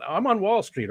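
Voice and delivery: a high, light mock of a Wall Street type; mock smug voice.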